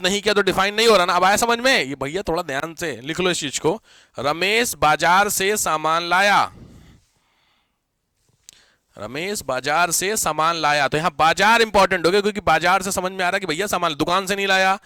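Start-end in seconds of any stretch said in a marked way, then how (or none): 4.2-10.9 slow, measured dictation for note-takers; drawn-out classroom dictation. Real, not acted. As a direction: read it out slowly, word by word. Dictating the example sentence to write down.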